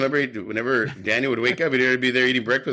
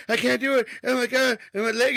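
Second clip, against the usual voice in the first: put-on high, whining kid voice; mock Daniel whimpering about his leg; whiny Daniel; 8 semitones higher than usual. Imitating a whiny Daniel complaining about his leg.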